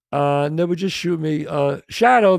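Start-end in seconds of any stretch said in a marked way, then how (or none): 1.9-2.4 raised, higher call for a stand-in. Retold or imitated. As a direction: summon someone on set, louder. Crew calling for the stand-in.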